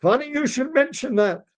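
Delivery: high-pitched wailing oy; lamenting father.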